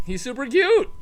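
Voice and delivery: cooing. Real, not acted. Cooing over dog.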